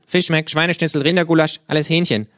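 high, loud kangaroo with a laugh at the end. Acted. The kangaroo insists to the narrator that everything is chicken, high and loud. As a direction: rattle off the list as the smug kangaroo.

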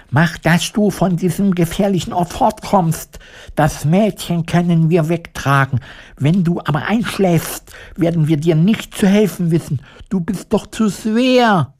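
high, sharp order to run; urgent scarecrow. Acted. Scarecrow urging the lion to escape, high urgent command. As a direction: push the lion to flee. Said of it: "sagte der Scheuch zum Löwen".

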